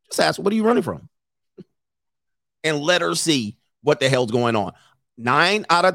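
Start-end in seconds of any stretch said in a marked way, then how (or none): 0.4-1.1 high, light teasing question; casual joke, lightly asked; joking. Shown as a demonstration. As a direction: pop it out playfully, higher. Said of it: "just say it as a joke".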